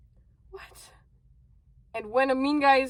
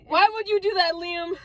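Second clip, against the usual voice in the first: high mock-dramatic protest; a half-hearted replay of an outraged cry; mock scream; 7 semitones higher than usual. Girl screaming at Liam after the punch, mock scream. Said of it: "you're screaming at him like".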